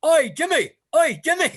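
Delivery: put-on high, loud Irish-accented shout. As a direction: call out to a pal in a mock Irish accent. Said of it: "this Irish accent calling him Jimmy".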